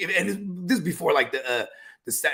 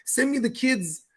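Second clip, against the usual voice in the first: mom voice; 5 semitones higher than usual. Retold or imitated. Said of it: "She's like".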